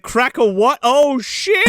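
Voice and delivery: high double-take, rising to alarm; mock startled Santa. Santa hearing about crocodiles, high mock alarm. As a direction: go from puzzled to hammed-up panic. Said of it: "And he's like".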